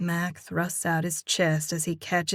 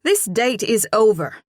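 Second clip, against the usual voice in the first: loud and higher, furious; angry; 4 semitones higher, 8 dB louder than usual. Acted. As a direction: snap it out, done with him. Angry woman ends the date.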